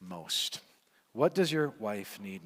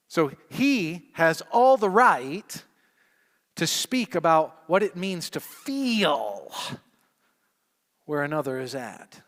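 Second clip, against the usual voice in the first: preaching build; 4 semitones higher, 10 dB louder than usual. Acted.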